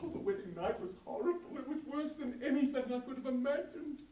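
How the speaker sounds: an overwhelmed pioneer woman near tears; fretful settler woman. Acted. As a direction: complain breathlessly about floods, fire and quakes. Settler woman recalls the wedding.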